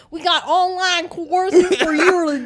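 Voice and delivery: fake Southern accent. Scam caller selling courses in a put-on Southern accent. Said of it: "Southern accents and stuff".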